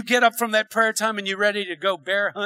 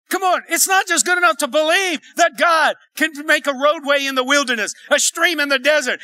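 loud, driving, rousing exhortation; rally the congregation with punched phrases; full-force preaching; 6 semitones higher, 5 dB louder than usual. Real, not acted.

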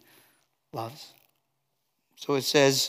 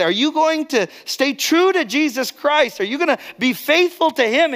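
high, loud pressing questions; challenging questioner; 11 semitones higher, 5 dB louder than usual. Acted. People pressing a persecuted believer to stay true, challenging. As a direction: put them on the spot, pitched right up.

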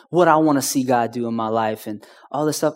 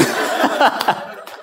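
breathy laugh; 8 semitones higher than usual. Real, not acted. Laughing at kids' remark.

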